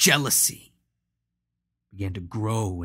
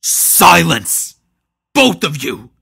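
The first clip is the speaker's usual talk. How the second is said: a booming, much louder shout; thunderous command; 6 semitones higher, 14 dB louder than usual. Acted. Maul silencing both brothers with a thunderous shout. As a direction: boom it out like thunder. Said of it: "in a voice like thunder".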